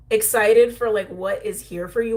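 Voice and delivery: usual speaking voice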